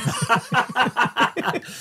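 high burst of laughter; real laugh. Real, not acted. Laughs at the psychopath remark.

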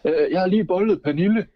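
a car dealership employee shouting a crude brag at the comedian; drunk guest. Retold or imitated. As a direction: blurt it out like a drunk coworker at the party. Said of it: "de siger".

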